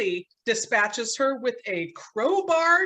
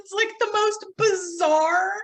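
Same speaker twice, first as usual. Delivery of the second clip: laughing; 10 semitones higher than usual. Real, not acted. Cracking up at the absurd ending.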